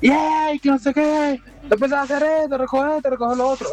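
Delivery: high-pitched nagging wife shouting at her husband; shrieking wife. Retold or imitated. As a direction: screech orders like a nagging mother. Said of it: "como la señora le grita al señor".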